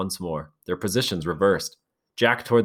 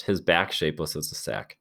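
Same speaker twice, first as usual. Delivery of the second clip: plain reading voice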